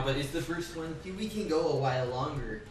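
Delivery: odd voice. Real, not acted. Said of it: "I don't know why I'm talking like this".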